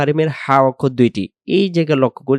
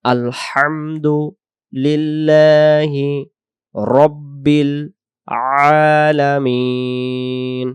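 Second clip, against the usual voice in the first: Alamin drawn out, very slow; slow recitation; 2 semitones higher, much slower than usual. Shown as a demonstration. Teacher ending the verse in slow recitation. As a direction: stretch Alamin long like a chant.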